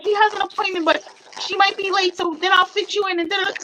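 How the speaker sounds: high, eager gushing voice; excited girl's voice. Retold or imitated. Retelling an eager store girl, high and gushing. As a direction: gush it out like a girl who can't stop talking. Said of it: "She was like".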